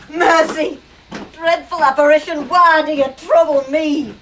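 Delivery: terrified Scrooge. Acted. Scrooge on his knees begging Marley's ghost, loud and high. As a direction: beg the ghost for mercy.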